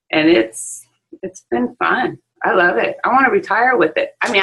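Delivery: usual speaking voice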